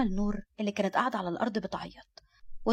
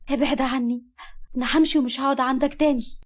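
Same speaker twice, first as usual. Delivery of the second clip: high, loud outburst building to a cry; desperate shouting; 5 semitones higher, 6 dB louder than usual. A narrator's reading. Nour fights off the man hurting her, shouting desperately. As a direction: push him away with your voice.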